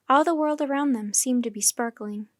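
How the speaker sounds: ordinary delivery, nothing marked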